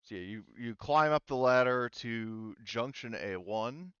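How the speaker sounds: ordinary delivery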